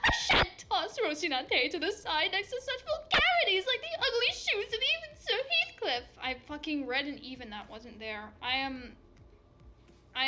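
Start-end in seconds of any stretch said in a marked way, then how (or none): 0.0-6.0 high, loud archaic knight plea; dramatic chivalric protest; pleading olde knight. Acted. Don Quixote pleads.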